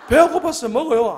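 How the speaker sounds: very high, loud mock plea; comic protest. Preacher excuses eating the snacks to colleague, comic high protest. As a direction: plead hunger, hammed up for laughs.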